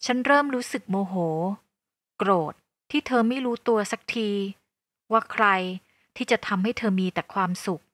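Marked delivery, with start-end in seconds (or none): none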